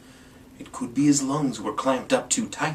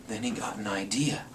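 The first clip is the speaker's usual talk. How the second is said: usual speaking voice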